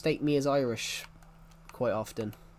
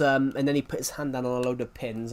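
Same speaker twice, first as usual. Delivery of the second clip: ordinary delivery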